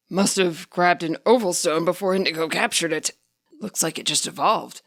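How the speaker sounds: lightly voiced old man. A narrator's reading. Ingo voiced in story.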